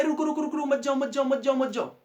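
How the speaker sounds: high-pitched, rapid-fire pleas to stop; calling out to halt a running child, words repeated urgently; urgent warning. Acted. Family calling after a small child heading for water, urgent warning.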